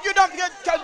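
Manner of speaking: ordinary delivery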